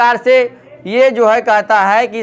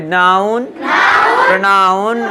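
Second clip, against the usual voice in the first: stretched-out words with rising endings; drawn-out classroom drill; say-along drill; much slower than usual. Shown as a demonstration. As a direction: lead the class in a slow repeat-after-me chant. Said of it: "बोलिए हमारे साथ".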